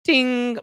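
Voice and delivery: high loud ding like a school bell; imitated bell. Host playing teacher opening class, high bell ding.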